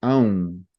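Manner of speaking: "Aum" through the nose, mouth closing at the end; nasal "Aum", humming out; Portuguese nasal "Aum". Shown as a demonstration. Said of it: "it's a nasal sound".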